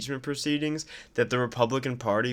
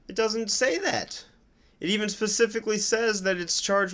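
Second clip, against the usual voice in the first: incredulous; 9 semitones higher than usual. Real, not acted.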